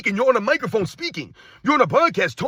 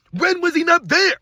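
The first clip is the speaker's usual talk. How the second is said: indignant question; 8 semitones higher, 5 dB louder than usual. Real, not acted. Indignant defence of Ewing.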